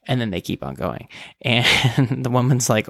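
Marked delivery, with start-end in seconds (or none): none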